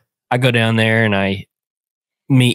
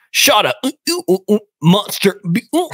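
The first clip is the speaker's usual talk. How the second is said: higher, choppy imitation of a call cutting out; garbled phone voice; 12 semitones higher than usual. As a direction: say it like a bad mountain signal is chopping the words. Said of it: "the mountain signal".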